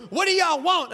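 loud, high mock yell of an angry woman; confrontational yell. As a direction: holler it at unwanted visitors. Huge woman confronts him at door.

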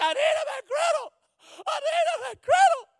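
high, loud screamed demand; yelling customer. Retold or imitated. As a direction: imitate a customer screaming at the drive-thru. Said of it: "And she is yelling".

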